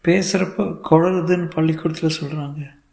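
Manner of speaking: put-on high-pitched little boy; child voice. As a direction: tell it like a small child. Small son reports his slurring. (சிறுவன் குரலில்)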